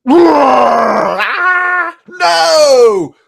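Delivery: high loud despairing scream; screaming cry. Retold or imitated. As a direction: cry out as the cave swallows you. Thief swallowed by cave.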